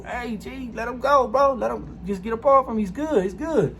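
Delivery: high pitched neighbor pleading. Retold or imitated. Neighbor Psycho Mike calling out.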